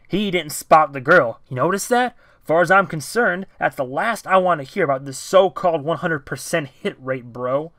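pushy guard cutting the hero down; angry confrontation. Acted. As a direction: jab every word at him like a finger. Owen jabs finger, confronts TJ.

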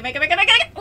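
high, loud pleading chant; frantic urging. Real, not acted. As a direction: yell at the game to make it. Urging character to make it.

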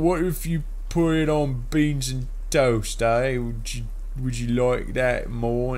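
high-pitched, hammed-up British accent; mock British accent. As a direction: offer it like a caricature Brit. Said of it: "They're like".

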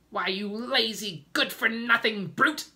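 high, loud, scornful tirade; angry shout. A narrator's reading. Aunt Spiker berating James for his plea, shouting. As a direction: shout the insult at full force. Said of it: "Aunt Spiker shouted".

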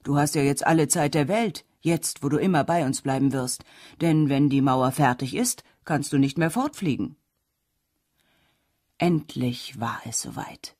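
usual speaking voice

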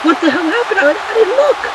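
high-pitched, baffled outburst; startled confusion. Real, not acted. Commentator missed the action, startled and baffled. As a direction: blurt it out, caught off guard.

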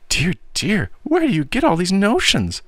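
high-pitched, fretting auntie voice; credulous, querying aunt. A narrator's reading. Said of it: "queried Miss Meadow, whose confiding spirit".

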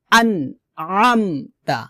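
slow আনআমতা with squeezed throat on আম; drawn-out ain in আনআমতা; pressed ain. Shown as a demonstration. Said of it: "আইনটা চাপ দিতে হবে".

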